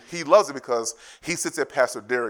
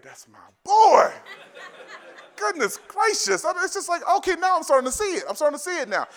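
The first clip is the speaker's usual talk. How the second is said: delighted high laugh; 6 dB louder than usual. Real, not acted. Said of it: "laughing, delighted high".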